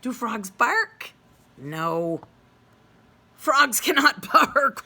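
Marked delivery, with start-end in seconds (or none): 3.4-4.8 loud, higher dog voice with a laugh; the dog laughing at Freddy, then woofing. A narrator's reading. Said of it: "said the dog".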